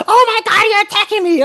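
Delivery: high, loud mock shriek of offence; mock offended woman. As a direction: squeal it like an outraged woman. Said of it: "imagine walking up to a girl".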